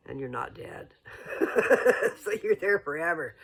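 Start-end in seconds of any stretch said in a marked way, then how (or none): 1.1-2.2 amused laugh. Real, not acted. Laughing at eternal floating.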